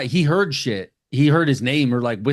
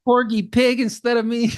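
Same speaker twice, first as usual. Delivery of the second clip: much higher, indignant mock squawk; outraged disbelief; 10 semitones higher than usual. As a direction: play the jilted guy, offended and incredulous. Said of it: "And he's like".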